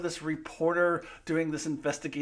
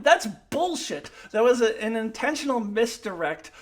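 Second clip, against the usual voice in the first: loud, higher, exasperated; angry rant; 9 semitones higher, 9 dB louder than usual. Real, not acted. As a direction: vent hard at the writers. Angry rant about twist.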